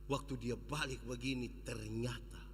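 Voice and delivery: low, soft suspenseful build; hushed suspense. A narrator's reading. Preacher telling congregation the story, hushed suspense. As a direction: drop the voice before the reveal.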